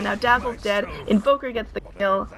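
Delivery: usual speaking voice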